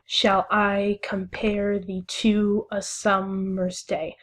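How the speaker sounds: slow "Shall I compare thee to a summer's day", stressing I, PARE, TO, SUM, DAY; "Shall I compare thee to a summer's day" in measured iambs, every second syllable weighted; sounding out stresses. Shown as a demonstration. Teacher reading the sonnet's first line, stresses sounded out in "Shall I compare thee to a summer's day". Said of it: "trying to sound out the".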